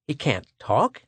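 startled question, rising sharply at the end. A narrator's reading. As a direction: query it in surprise. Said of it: "Schecht seemed startled".

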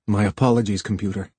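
restrained, stiff apology; stiffly. A narrator's reading. Harlan to his superior, not yet repentant, stiffly. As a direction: apologise formally, only half repentant. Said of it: "said Harlan stiffly".

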